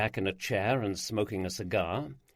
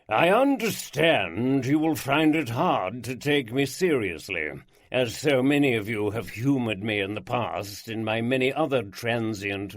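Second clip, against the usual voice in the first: grand showman lord; 3 dB louder than usual. Acted. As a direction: unveil himself like a stage magician.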